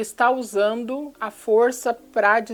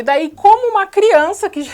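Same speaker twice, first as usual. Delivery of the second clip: laughing; 9 semitones higher, 8 dB louder, slower than usual. Real, not acted. Laughs mid-sentence telling story.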